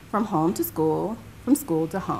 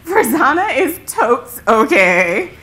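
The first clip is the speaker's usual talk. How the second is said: loud, amused retelling, higher at first; acted amusement with raised voice; imitating laughing friend; 4 semitones higher, 7 dB louder than usual. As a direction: play the friend talking between laughs. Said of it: "in between laughter".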